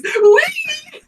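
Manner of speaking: high, loud, long gleeful whoop; Loran. Acted. Game master has the character jump up beside a player, high gleeful squeal. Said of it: "Loran does get up next to you and goes".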